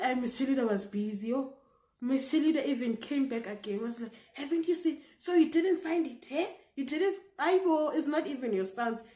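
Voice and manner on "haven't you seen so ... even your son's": high, loud retold confrontation; mock quarrelling. Teacher returns to accuse the pupil, loud mock quarrelling. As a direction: challenge loudly in an imitated quarrel.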